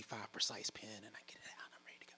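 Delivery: breathy hush, barely voiced; whisper. Real, not acted. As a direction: whisper it like a secret. Scrambling for pen, hushed.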